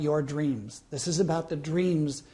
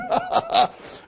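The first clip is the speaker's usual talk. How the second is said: laugh. Real, not acted. Laughter after the tease.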